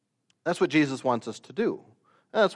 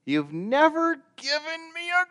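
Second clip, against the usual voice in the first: much higher, strained sulky complaint; whiny older son; 12 semitones higher, 6 dB louder than usual. Acted. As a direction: squeal it like a sulking son. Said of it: "He says this".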